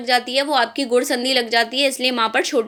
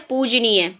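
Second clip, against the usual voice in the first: ordinary talk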